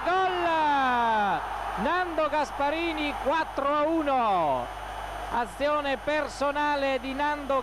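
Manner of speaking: breathless italian goal commentary. Real, not acted. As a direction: explode with joy at the goal. Goal scored, commentator erupts.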